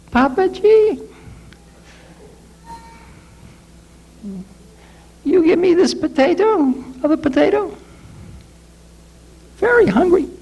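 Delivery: very high pleading beggar voice; old beggar. Retold or imitated. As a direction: beg for food in a thin high voice. Old beggar begging for potato.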